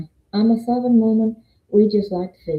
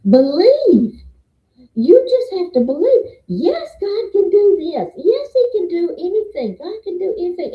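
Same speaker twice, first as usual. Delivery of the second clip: much higher, ringing out Believe like a revival preacher; fervent preaching; 9 semitones higher, 4 dB louder than usual. Retold or imitated. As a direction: lift them up with fiery conviction. Quoting a woman preaching belief.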